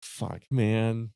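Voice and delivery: ordinary talk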